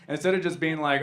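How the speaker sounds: normal talking voice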